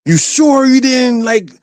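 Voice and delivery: mock mother cross-examining son; accusing mother. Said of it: "she was like".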